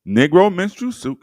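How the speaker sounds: high, loud mock sales pitch; mock showman. Host shouting the costume ad's heading to viewers. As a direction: call it out like a barker. Watch me put it all together.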